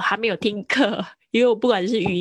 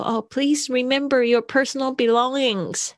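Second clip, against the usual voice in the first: a light airline-announcement lilt, higher; announcer voice; 6 semitones higher than usual. Retold or imitated. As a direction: mimic a flight attendant's reminder. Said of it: "他会说". (模仿空服员提醒乘客)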